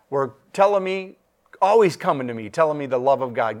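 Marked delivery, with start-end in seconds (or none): none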